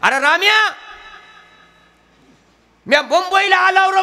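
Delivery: loud, high rustic yell into a phone; village farmer on the phone. Retold or imitated. Farmer calling his friend from Mumbai, loud rustic shout. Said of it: "आपल्या शेतकरी माणसाचं बोलणं कसं असतंय".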